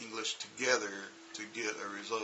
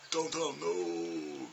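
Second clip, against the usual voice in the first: "Downtown" stretched out slowly; 6 semitones higher, much slower than usual. Shown as a demonstration. Teacher showing how to say "Downtown" slowly. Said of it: "Say it slow".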